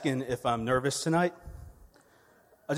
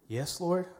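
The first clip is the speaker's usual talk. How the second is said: put-on child answering God; 7 semitones higher than usual. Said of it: "He said".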